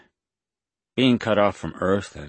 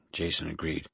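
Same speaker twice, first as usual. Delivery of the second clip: usual speaking voice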